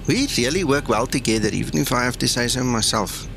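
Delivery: usual speaking voice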